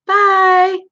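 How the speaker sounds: squeaky high farewell; high cute bye. Real, not acted. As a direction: chirp it out cutely. Stream sign-off.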